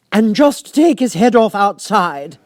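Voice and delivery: high, loud, slow royal command; imperious queen. A narrator's reading. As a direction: order it like a haughty queen. Queen voiced in story.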